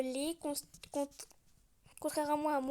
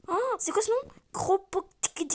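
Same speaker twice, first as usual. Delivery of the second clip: loud, higher startled exclaim; surprised; 5 semitones higher, 7 dB louder than usual. Acted. Friend hearing the strange street name, loud surprise. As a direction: blurt it out in disbelief.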